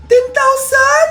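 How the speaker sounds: loud, high, drawn-out astonishment; astonished. Acted. Child seeing a bigger cash gift, astonished. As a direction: cry it out in disbelief.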